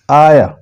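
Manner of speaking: आया glided into a single sound; one blended sound. Shown as a demonstration. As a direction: run आ-ए-आ together as आया. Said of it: "लगे कि एक ही sound है".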